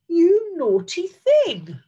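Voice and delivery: slow, loud, high rebuke; thundering scolding. Acted. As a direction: scold the child in outrage. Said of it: "he thundered".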